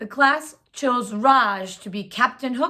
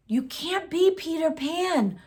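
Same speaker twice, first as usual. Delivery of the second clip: put-on raised, hushed voice of a girl; whispered; 7 semitones higher than usual. A narrator's reading. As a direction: say it behind your hand, a little higher. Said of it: "whispered Natalie".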